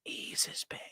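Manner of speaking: breathy ghostly whisper, barely there; eerie whisper. Acted. Streamer echoing his own line to chat in a spooky whisper. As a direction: whisper it like a voice from beyond.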